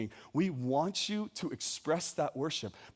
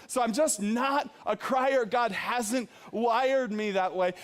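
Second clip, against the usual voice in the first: ordinary delivery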